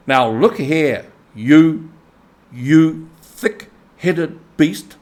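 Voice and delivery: slow, loud, biting reproach; really angry. A narrator's reading. Rat furious at the mole, slow loud anger. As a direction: spit out the insult. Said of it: "really angry".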